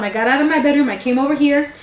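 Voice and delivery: usual speaking voice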